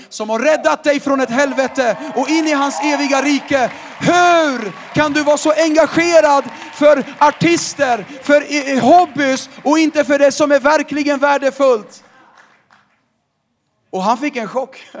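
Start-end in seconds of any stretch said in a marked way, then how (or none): 0.1-12.0 high, loud, shouted sermon; full-force preaching. Acted. Preacher shouting at the congregation about real passion. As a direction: thunder it from the pulpit.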